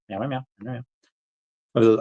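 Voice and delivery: playful meowing. Pretend. Playful cat noises at friend.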